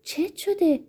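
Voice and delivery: high, alarmed acted cry; narrator's restrained version of a woman's shriek; cried out. A narrator's reading. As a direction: shoot the question up high in alarm. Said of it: "فریاد کشید".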